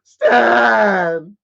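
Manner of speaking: loud yell of a name, rising; calling out. Shown as a demonstration. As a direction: holler the name. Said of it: "That's what I'm gonna say".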